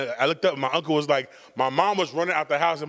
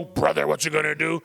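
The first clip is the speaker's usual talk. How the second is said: Hulk Hogan impression. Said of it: "Hulkamania in me".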